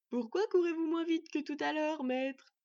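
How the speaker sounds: goofy teasing question; idiotic, mocking crow. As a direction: ask like a cheeky, dim-witted crow. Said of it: "s'enquit Murk d'un air idiot qui le rendait goguenard".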